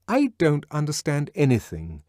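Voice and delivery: decisive. A narrator's reading. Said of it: "she said with decision".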